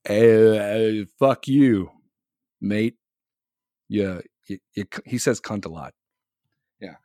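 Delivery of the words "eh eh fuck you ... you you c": low, loud, slurry mock drunk mate; imitating drunk Caps. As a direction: do your drunk mate mouthing off. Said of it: "Drunk Caps is like".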